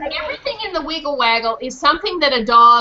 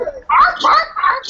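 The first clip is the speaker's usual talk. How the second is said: barking like a dog; 10 semitones higher, slower than usual. Shown as a demonstration. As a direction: bark it out like an excited puppy. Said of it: "sing after me and do what I do".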